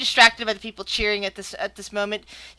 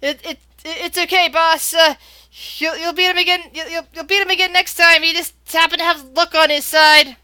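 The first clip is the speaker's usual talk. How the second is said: higher, lightly voiced dwarf supporters; consoling dwarves; 5 semitones higher, 6 dB louder than usual. Dwarves to their beaten boss, higher consoling voice. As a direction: lightly act a stammering crony consoling the boss. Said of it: "You hear the dwarves saying".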